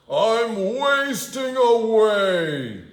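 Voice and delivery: high, slow whiny wolf wail; wolf complaining. Acted. Wolf whining to himself about hunger. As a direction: moan it like a starving wolf. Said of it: "he complained".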